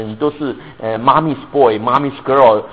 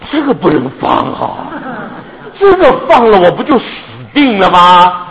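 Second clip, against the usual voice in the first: panicked protest; 5 semitones higher, 5 dB louder than usual. Retold or imitated. Man refuses to let go.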